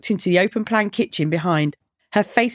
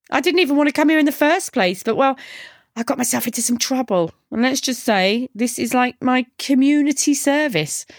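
put-on higher-pitched girl character; offhand young woman, pitched up; candid cheeky girl; 6 semitones higher than usual. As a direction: explain yourself grudgingly in a raised female voice. Girl explains herself in story.

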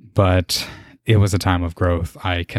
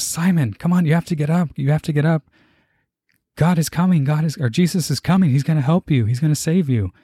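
high pleading friend's voice; urgent, much higher plea; friend urging; 8 semitones higher than usual. Retold or imitated. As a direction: voice the friend begging him to get up. Friend in dream urging him up.

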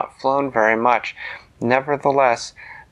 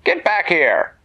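calling out higher and louder after a runaway goose; farmer yelling; 9 semitones higher, 3 dB louder, slower than usual. A narrator's reading. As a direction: bark it across the farmyard, louder.

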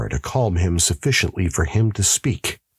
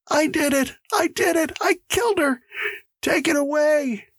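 high, panicked screaming without full volume; screamed confession. Acted. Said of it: "he screamed".

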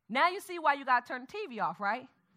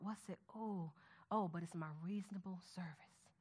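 soft, lower, near-whisper as if reading alone; whispered private meditation on the verse; hushed close reading; 7 semitones lower, 13 dB quieter than usual. Shown as a demonstration. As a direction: pore over each word under your breath. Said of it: "take your time and you're going to read it".

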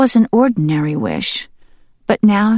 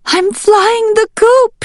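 high, loud, slow hen cluck; 5 dB louder, slower than usual. Acted. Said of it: "she clucked".

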